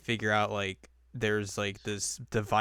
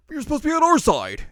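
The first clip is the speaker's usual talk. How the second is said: high, loud mock outrage; shrill-free high shouted protest of goons; indignant shout; 12 dB louder than usual. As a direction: yell it like the betrayed goons.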